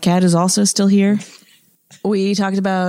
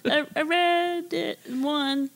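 sheepish excuse; 9 semitones higher, 5 dB quieter, slower than usual. Retold or imitated. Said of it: "responding like".

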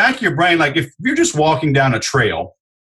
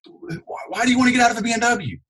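much higher, upbeat buddy voice; friendly salesman impression; 12 semitones higher, faster than usual. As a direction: compliment the car with bright enthusiasm. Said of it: "But I'm going".

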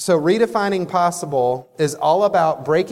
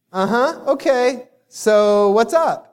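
unimpressed; 6 semitones higher, slower than usual. Retold or imitated. Girlfriend unimpressed by his news.

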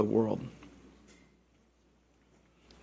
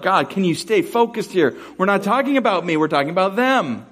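exasperated whine; 6 semitones higher than usual. Pretend. Exasperated believer to God.

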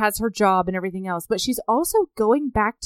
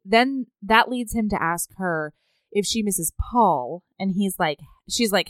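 ordinary talk

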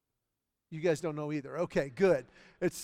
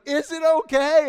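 much higher, loud acted shout; imitated strained call; 9 semitones higher, 12 dB louder than usual. Dog walker holding huge dogs calls to neighbor, loud mock strain. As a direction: call out as if struggling to hold on.

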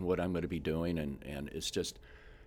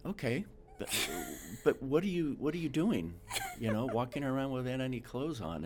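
bewildered; 7 semitones higher than usual. Retold or imitated. Bewildered thought at the scene.

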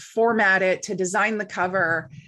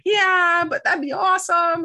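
high, louder eager agreement; eager yes; 9 semitones higher, 5 dB louder than usual. Retold or imitated. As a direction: play it cool but excited.

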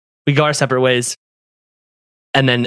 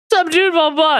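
high, loose imitation of a drunk buddy; mock-drunk party greeting; hammered friend; 12 semitones higher than usual. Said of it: "he's hammered".

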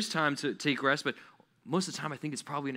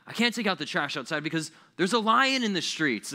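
higher-pitched mock protest; hammed-up excuse, pitch raised; kid's absurd excuse; 5 semitones higher, 4 dB louder than usual. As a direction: protest like a kid dodging chores. Child giving absurd excuse to parents.

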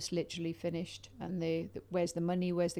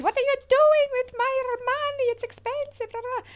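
much higher and louder indignant shriek; outraged Italian; 9 dB louder than usual. Retold or imitated. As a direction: shriek it like the outraged date at the door. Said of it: "the door just flung open and he goes".

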